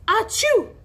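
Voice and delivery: high, loud acted "achoo"; mimicked sneeze. A narrator's reading. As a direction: burst out with a fake sneeze. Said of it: "all of a sudden he'd sneeze".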